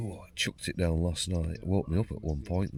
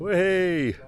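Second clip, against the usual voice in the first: loud cheer; 14 semitones higher, 8 dB louder than usual. Real, not acted. Greeting friends on arrival.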